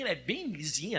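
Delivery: ordinary talk, nothing marked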